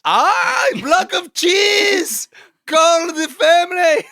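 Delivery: very high, loud, slow mock shriek; shrieking excitement. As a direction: scream with joy over the prize. Mock excitement over cheese prize.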